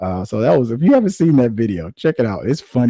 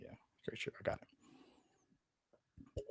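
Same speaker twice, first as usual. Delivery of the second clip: whispered, barely audible agreement; whispered reluctant okay; mock whisper; 27 dB quieter, much faster than usual. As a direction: hush it like a secret. Mock reply in a hush.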